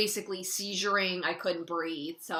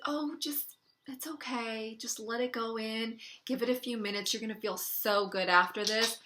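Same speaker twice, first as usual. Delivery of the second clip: reassuring medic; 3 semitones higher, 3 dB quieter than usual. Retold or imitated. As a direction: brush off the worry with a breezy, soft there-there. Medic dismissively reassuring patient.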